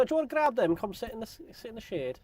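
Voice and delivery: friendly Northern English invitation; northern accent. Retold or imitated. Woman inviting him to shade, Northern accent. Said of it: "had to deal with that accent".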